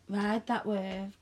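usual speaking voice

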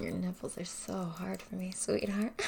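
ordinary talk

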